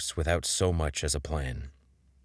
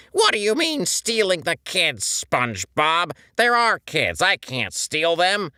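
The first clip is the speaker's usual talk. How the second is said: very high, loud cartoon sponge, hurt and accusing; high, loud, indignant sponge character; SpongeBob voice, upset; 6 dB louder than usual. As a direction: squeal it out like a wounded SpongeBob. SpongeBob voiced through argument.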